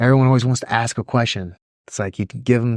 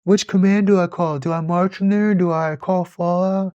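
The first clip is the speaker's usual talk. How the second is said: much higher, panicky mock questions; much higher flustered mock voice; flustered kid; 9 semitones higher than usual. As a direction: play a lost young Marine at formation. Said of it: "he's like".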